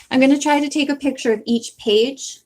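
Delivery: normal talking voice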